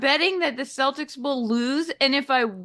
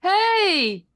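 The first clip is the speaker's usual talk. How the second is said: loud, high cheer of triumph; excited hey; 9 semitones higher, 5 dB louder than usual. Real, not acted. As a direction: shout it out in celebration. Cheering the won bet.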